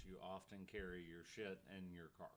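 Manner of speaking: ordinary delivery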